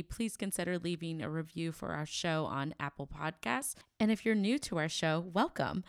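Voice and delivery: bright, upbeat promo read; podcast outro read. Acted. Host closing the podcast, asking for reviews, upbeat promo read. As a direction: sell the show to the listeners.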